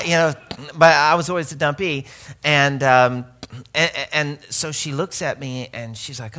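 ordinary talk, nothing marked

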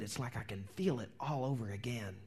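near-whisper, as if reliving it; pained hushed recollection. Acted. Preacher voicing someone reliving old pain, pained hush. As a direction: let it sink into a soft, breathy hush.

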